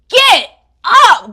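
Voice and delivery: loud, high, exasperated order; punched, shouted command; scolding yell. Acted. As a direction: yell it at a hopeless friend. Storyteller scolds the begging character.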